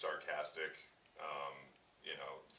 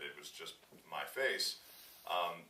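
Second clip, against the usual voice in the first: ordinary delivery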